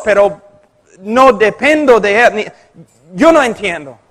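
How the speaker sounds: usual speaking voice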